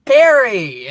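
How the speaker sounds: high, loud happy shout of a name; excited greeting. Retold or imitated. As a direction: call out the name like a thrilled kid. Kid greets Harry at dinner.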